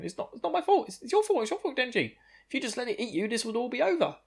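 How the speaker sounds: high, frantic shrieky pleading; panicked blaming. Retold or imitated. Kobeni freaking out and blaming Denji, high panicked squeal. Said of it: "still freaking out in the background going".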